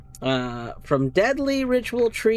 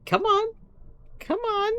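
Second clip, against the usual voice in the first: much higher pleading cat voice; voicing an impatient cat. The cat begging her for treats, high pleading. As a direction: beg like an eager pet. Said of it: "He's like".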